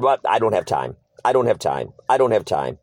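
low-pitched brush-off, repeated; dismissive pastor. Retold or imitated. Imitates pastor brushing him off repeatedly.

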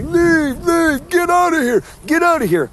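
high squealing parody of the hecklers; imitating mockers. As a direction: ape the jeering mockers. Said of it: "mockers that tell me".

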